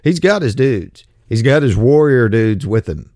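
put-on measured reading voice for an old text; scripture reading. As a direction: read it like the King James text from the pulpit. Reads scripture passage aloud.

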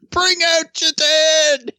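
high, slow drawn-out town crier call; plague cart crier. Pretend. Joking about calling out like the plague cart crier. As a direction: bellow it like a medieval corpse collector. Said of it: "Bring out your dead" cries".

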